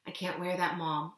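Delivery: ordinary delivery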